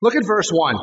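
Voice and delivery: ordinary talk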